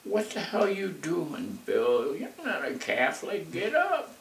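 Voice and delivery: imitating Artemis hollering in church, pitch shooting up; mock scream. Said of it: "Artemis screams out".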